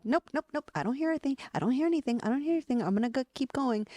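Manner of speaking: imitating someone ignoring their body; mock denial. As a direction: brush it off like you can't hear.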